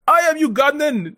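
put-on high-pitched Ugandan accent; high, hammed-up Ugandan accent; mock Ugandan yell. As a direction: imitate a guy yelling in a Ugandan accent. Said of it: "he was yelling at the streamer".

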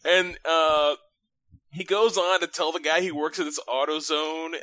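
usual speaking voice

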